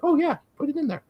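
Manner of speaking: high, casual mock owner saying yes; breezy mock agreement. Retelling how the owners suddenly agreed, breezy high mock yes.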